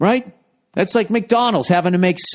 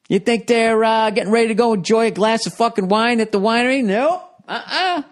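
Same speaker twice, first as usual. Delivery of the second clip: teasing; 6 semitones higher than usual. Real, not acted. Teasing the audience with questions.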